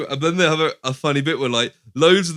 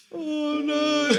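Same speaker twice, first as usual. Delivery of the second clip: high, drawn-out mock wail; hammed-up horror at losing treasure; mock dismay; 4 dB quieter than usual. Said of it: "he's like".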